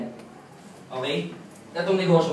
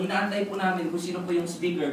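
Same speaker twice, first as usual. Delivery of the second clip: ordinary talk, nothing marked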